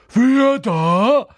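loud, high poltergeist cry; sudden challenge. Acted. Peeves hearing intruders on the stairs, screeching. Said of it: "fragte er plötzlich".

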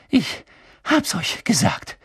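high, slow panting between words; gasping. A narrator's reading. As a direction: gasp it out in bursts, winded. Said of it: "keuchte Hermine".

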